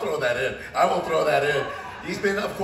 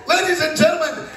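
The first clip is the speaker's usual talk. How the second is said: lucha libre announcer; 5 dB louder than usual. Pretend. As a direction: shout the name to the back row. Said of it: "did not expect a pro wrestling entrance".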